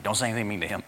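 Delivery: a soft, low aside from a mother; hushed mother's warning. Retold or imitated. Preacher recalling a friend's mom warning her son, softly. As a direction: say it quietly, protective.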